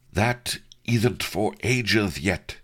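slow panting lecher; lightly voiced panting lecher. A narrator's reading. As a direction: pant out the words, lustful. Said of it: "panted the portly lecher".

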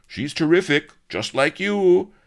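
high, loud dutiful chant; high, loud sycophantic chorus; obedient chorus. Acted. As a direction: chant it like cowed officers. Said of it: "obediently chorused".

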